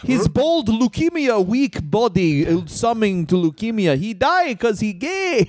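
high, slow broken-English Russian villain; slow comic Russian accent; mock Russian strongman. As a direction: ham up a cartoon Russian heel accent. Mock Russian wrestler promo.

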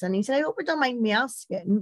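normal talking voice

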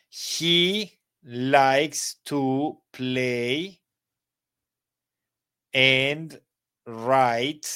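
very slow, word-by-word model; slow dictation. Shown as a demonstration. Teacher dictating the example to the class, very slow.

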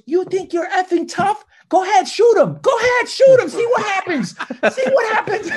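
high, loud mock yell daring the cop; brother's defiant yell. Younger brother taunts the armed cop, high mock yell. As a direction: shout it up high, taunting the gunman. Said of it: "and he goes".

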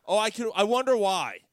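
loud, high mocking jab; sarcastic taunt. Mocking the woman at the bar, sarcastic taunt. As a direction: sneer it right back at her.

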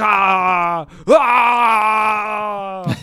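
long, high held cry; hammed-up warrior yell, held long; drawn-out mock yell. Imitating Chung Li waving the bandana around, long mock yell.

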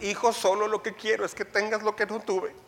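lightly voiced doting parent, rising plea; a hint of a sentimental father; pleading parent. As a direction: beg like a parent who suffered. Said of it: "parent pleading".

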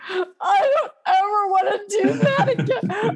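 high, tearful laugh-cry; half-laughing, half-crying plea. Retold or imitated. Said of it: "I was crying".

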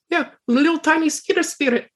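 high squeaky voice of the odd companion; Talks to herself voice. Acted. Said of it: "and she says".

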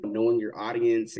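ordinary delivery

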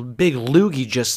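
ordinary talk

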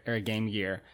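ordinary delivery

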